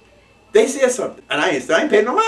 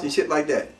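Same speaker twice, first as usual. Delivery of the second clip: normal talking voice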